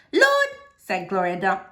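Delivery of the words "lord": high, loud exclaimed lord; exasperated lord. Acted. Gloria reacting to the peanut butter plan, exclaiming. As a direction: throw up your hands at the child.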